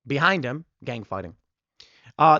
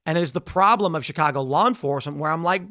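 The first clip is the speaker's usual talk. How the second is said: ordinary talk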